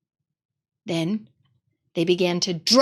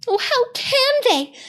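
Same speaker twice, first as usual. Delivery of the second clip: much higher, distressed cry; tearful girl. Acted. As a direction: plead in tears as a young girl. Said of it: "tears streaming down her cheeks".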